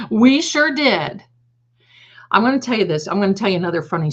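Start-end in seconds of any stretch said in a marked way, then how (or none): 0.1-1.2 playful pride. Real, not acted. Proud quip after laughing.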